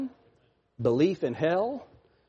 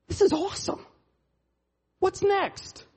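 higher eager excitement; excited; 13 semitones higher than usual. Acted. Preacher voicing eager believer, excited. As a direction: sound thrilled about the future.

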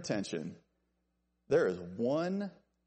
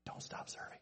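barely audible whisper; whispered caution; 15 dB quieter than usual. Shown as a demonstration. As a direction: whisper it as a careful warning. Hushed caution, then repeated firmly.

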